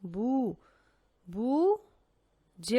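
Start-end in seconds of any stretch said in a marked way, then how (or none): none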